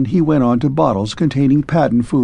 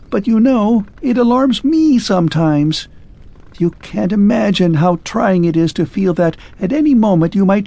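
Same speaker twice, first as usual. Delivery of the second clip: a machine-man begging to be pitied; higher, despairing clockwork man; 7 semitones higher than usual. A narrator's reading. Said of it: "implored ... in despair".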